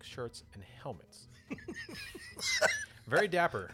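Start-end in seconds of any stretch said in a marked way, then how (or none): 1.3-3.0 loud laugh. Real, not acted. Laughs at dapper aliens. 3.1-3.7 amused. Real, not acted. Amused comment, loud.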